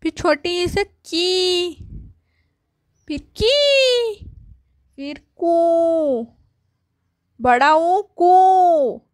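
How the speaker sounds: slow, stretched की and को, each vowel held long; drawn-out syllables. Shown as a demonstration. Teacher sounding out की, को slowly for children. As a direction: draw out every syllable for the class. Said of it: "teacher drawing out each syllable".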